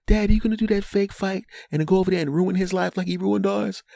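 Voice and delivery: put-on high excited child voice; lightly voiced excited kid. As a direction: chirp it like an eager kid. Kids voiced in revenge scheme.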